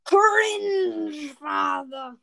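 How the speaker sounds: loud mock shout. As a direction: yell it out dramatically. Mock outburst at old videos.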